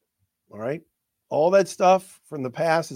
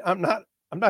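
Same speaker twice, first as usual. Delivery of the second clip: ordinary talk, nothing marked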